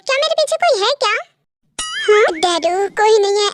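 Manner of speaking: usual speaking voice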